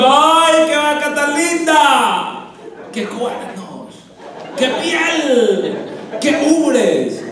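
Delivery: very high, drawn-out lovestruck gushing; swooning admiration. Acted. Little toad in love with a cow, swooning admiration. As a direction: gush like a besotted little creature, each exclamation stretched.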